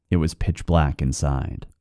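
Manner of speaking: usual speaking voice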